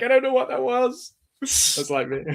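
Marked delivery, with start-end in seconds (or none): 0.0-1.1 a high, put-on voice, like the Community dean after his rap; squeaky high dean impression, confused by himself; imitating the dean. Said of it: "he's like".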